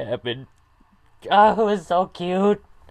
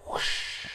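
whispered whoosh effect; 12 dB quieter than usual. Retold or imitated. Imitating the character's flip.

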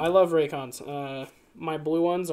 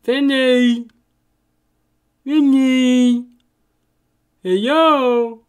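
loud call, higher; 8 semitones higher, 12 dB louder, much slower than usual. Real, not acted. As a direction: shout for him across the room. Calling out to absent friend.

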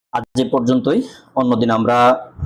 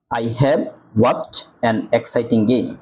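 slow demo reading; much slower than usual. Shown as a demonstration. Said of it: "এ উচ্চারণ হয়েছে".

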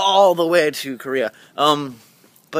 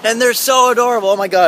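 gushing; 8 semitones higher, 7 dB louder than usual. Real, not acted. Gushing over puppies.

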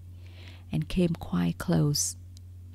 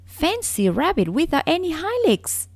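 mocking; 11 semitones higher, 3 dB louder than usual. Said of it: "And he began to laugh".

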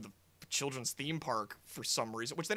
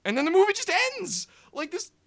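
much higher and louder, faster exclamation; real frustrated shout that the film just stops; outraged yell; 11 dB louder, faster than usual. Real, not acted. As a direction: burst out in disbelief. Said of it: "A-, and".

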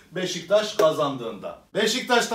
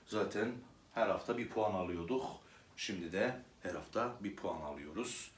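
mock mournful; 8 semitones lower, 9 dB quieter than usual.

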